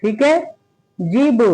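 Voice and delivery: ordinary talk, nothing marked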